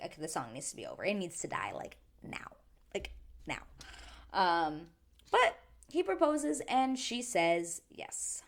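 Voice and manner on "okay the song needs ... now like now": softer, ending in a whisper; hiding, whispering. Retold or imitated. Hiding while Zach sings, whispering. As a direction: hiss it from hiding. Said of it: "I think I was hiding".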